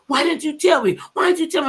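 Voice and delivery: high, loud pleading question; retold upset cry. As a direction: mimic the hurt friend crying out. Friend confronting her, retold.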